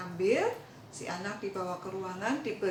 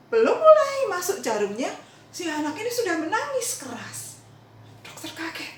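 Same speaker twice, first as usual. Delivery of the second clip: excited storytelling; 7 semitones higher, 9 dB louder than usual. A narrator's reading. Preacher telling how the boy cried before the needle, excited. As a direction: voice jumps up high and loud as the child bursts into tears. Dramatic moment, child cries out.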